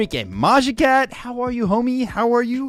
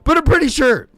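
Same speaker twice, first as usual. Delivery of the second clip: playful hype; 6 semitones higher, 6 dB louder than usual. Real, not acted. Hyping the event.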